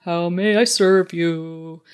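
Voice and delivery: sing-song mindless robot voice. Pretend. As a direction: chant it like a servile robot. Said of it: "singing in a robot voice".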